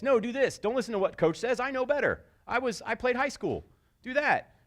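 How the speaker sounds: high, know-it-all parent voice; bossy parent. Retold or imitated. Pushy parent telling the kid to ignore the coach. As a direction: overrule the coach with authority.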